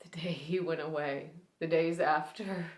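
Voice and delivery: quiet, grieving recluse with long, halting pauses; Allie Mayo. Acted. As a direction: start hushed, let old grief push the pitch up, then sink back. Performs Allie Mayo's grieving monologue.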